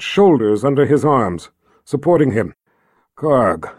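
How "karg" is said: faint, low, weakened call; weak voice. Acted. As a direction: speak weakly, wounded. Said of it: "Jagang said in a weak voice".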